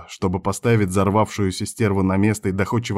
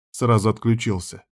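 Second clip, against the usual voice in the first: ordinary delivery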